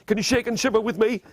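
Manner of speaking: ordinary delivery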